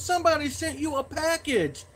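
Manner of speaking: high mock-mom holler; put-on high voice of a calling mother; yelling mom. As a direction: imitate Mom calling news through the house. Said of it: "and she yells".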